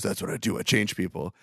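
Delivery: low, quiet mock Vin Diesel. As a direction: mutter it like a brooding action hero. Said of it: "And he goes".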